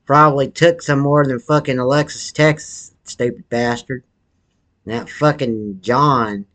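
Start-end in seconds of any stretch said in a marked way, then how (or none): none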